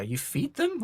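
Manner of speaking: high-pitched mock disbelief; incredulous. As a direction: question it as an astonished newcomer would. People's surprised reaction to shared food.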